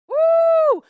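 high, loud preacher's whoop. Real, not acted. Preacher whoops after reading Jesus call out hypocrites, loud and high. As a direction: let out a big excited whoop.

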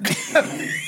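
very high, breathy, helpless laugh; squealing laugh; high wheezing laugh. Real, not acted. Laughing at own story.

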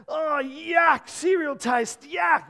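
a hammed-up child's disgust at cereal; disgusted kid. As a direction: whine it like a fussy boy. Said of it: "Samuel will be like".

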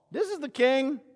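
high-pitched disbelief; incredulous. Acted. Crowd seeing their king nailed to a cross, incredulous. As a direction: say it as if you can't believe it.

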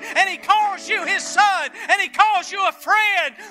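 loud, high, driving sermon delivery; preaching at full force. Acted. Pastor to congregation, preaching hard and loud.

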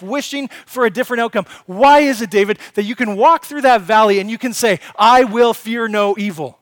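loud, driving sermon voice, pressing each question on the congregation; full-force preaching. Acted. Preacher pressing the congregation on fearing no evil, loud and driving. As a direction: preach it hard and build, hammering each why.

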